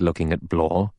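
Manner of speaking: ordinary delivery, nothing marked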